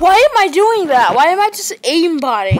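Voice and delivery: high, loud disbelieving question; real bewildered exclamation; baffled outburst. Real, not acted. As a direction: question yourself loudly in disbelief. Baffled by own play.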